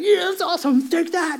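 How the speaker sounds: gleeful high, loud cheer; jumping up to gloat. Retold or imitated. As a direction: crow over the goal to tease your son. Cheering Tampa goal at son.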